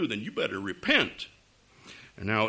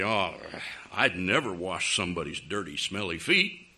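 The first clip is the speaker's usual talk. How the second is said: lower, grumbling objector; mock disgust; 6 semitones lower than usual. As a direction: play someone put off by a dirty chore. Voices a squeamish objector.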